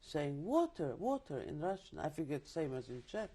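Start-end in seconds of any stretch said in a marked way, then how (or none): 0.4-1.4 desperate plea. Retold or imitated. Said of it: "blabbering the few words of Russian".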